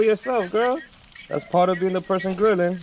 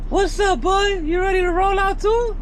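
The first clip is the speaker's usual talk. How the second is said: dog-talk voice. Real, not acted. Checking the dog is buckled up, high baby talk. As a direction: chirp it up high for the pup.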